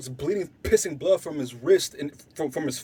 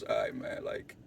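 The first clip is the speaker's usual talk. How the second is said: hushed retelling; 5 semitones lower, 6 dB quieter than usual. Retold or imitated. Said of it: "Korea goes".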